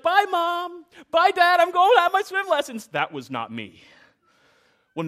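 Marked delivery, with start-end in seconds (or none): 0.0-2.9 high, bright-voiced happy child waving goodbye, a bit faster; sweet obedient kid. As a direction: play the nice kid cheerfully saying bye to the parents. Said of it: "You're like".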